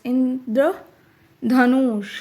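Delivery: इंद्रधनुष drawn out slowly, syllable by syllable; careful pronunciation. Shown as a demonstration. Teacher showing children how to say इंद्रधनुष, slow and careful. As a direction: stretch out इंद्रधनुष so each sound is clear. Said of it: "सही उच्चारण भी सिखाना है".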